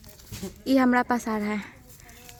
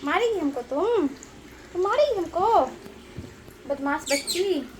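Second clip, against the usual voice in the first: mock-scold a naughty pet; fond scolding; 5 semitones higher, 3 dB quieter than usual. Real, not acted. Scolding the cow fondly. (प्यार से शरारती बछिया को डाँटना)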